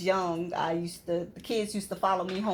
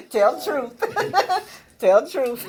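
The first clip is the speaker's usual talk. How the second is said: high, louder giggling through tell the truth; laughing urging; 10 semitones higher, 8 dB louder than usual. Real, not acted. Guest laughing as she presses her mentee for honesty.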